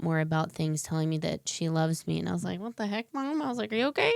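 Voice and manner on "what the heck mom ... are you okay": surprised. Retold or imitated. Retelling shocked reaction to mom.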